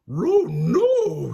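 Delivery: loud, high cartoon dog wail; Scooby crying out. Scooby finding a container of bugs, loud high cry. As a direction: cry out in alarm as a talking dog. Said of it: "Scooby cried".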